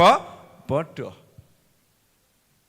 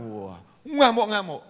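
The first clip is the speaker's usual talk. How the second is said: normal talking voice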